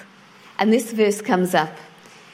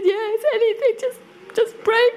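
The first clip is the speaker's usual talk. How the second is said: high-pitched mock desperation; imitated upset neighbour; 13 semitones higher than usual. Upset neighbour accepts the offer to pray, mock desperate. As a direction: agree desperately in a much higher voice. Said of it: "she's really upset".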